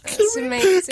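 high laughing squeal. Real, not acted.

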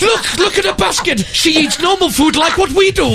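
high, laughing mock wonder; hammed-up high amazed shout; mock amazement. Joking about passer-by.

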